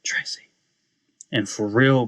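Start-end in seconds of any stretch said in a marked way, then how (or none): 0.0-0.4 soft, hushed single word; whisper. Retold or imitated. Brother to his sister on her deathbed, whisper. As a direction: whisper it into an ear. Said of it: "I whisper in her ear".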